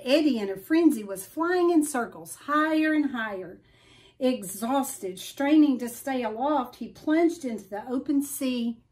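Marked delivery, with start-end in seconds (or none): none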